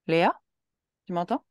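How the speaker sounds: loud call of the name. Acted. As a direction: call out to reach her. Calling the girl by name.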